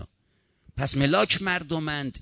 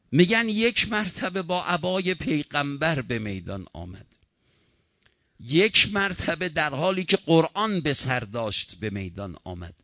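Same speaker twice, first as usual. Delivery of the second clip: ordinary talk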